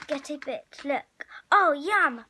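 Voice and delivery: plain reading voice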